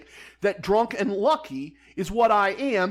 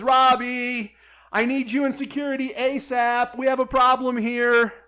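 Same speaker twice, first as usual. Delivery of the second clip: raised, higher voice calling for backup; retold urgent call; 6 semitones higher, 4 dB louder than usual. Dealer summoning the pit boss and security, imitated urgent call.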